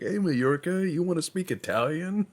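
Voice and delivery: high, wheedling sales pitch; sleazy tempter. Retold or imitated. Italy luring Majorca in a high wheedle. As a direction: coax them over slyly. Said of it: "They're like".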